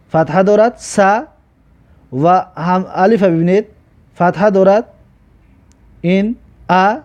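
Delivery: ordinary delivery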